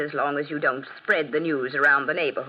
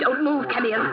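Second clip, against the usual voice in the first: frightened urging; 11 semitones higher, 4 dB louder than usual. Acted. Mother facing intruder.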